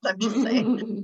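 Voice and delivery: drawn-out, slow playful aside. Real, not acted. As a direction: stretch it out teasingly. Playful aside after breath joke.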